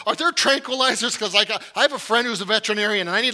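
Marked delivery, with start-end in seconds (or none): 0.1-1.2 mock panic. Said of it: "And I'm like, like".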